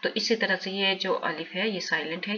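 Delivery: ordinary delivery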